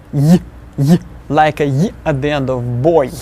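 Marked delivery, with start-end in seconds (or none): 0.1-1.0 put-on y as a brief, fleeting glide like the end of boy; short y glide. Shown as a demonstration. Teacher showing how the letter sounds. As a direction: clip y fast. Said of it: "The way it actually sounds is y".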